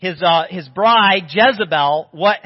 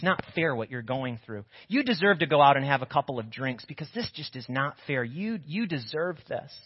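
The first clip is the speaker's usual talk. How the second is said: soft, much quieter coaxing temptation; tempting inner voice; 5 semitones lower, 8 dB quieter than usual. Retold or imitated. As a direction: lure them gently, much softer. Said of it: "It's that voice that says".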